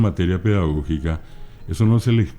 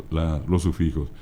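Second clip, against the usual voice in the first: usual speaking voice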